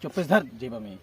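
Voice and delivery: hushed. Acted. Whispered hide it.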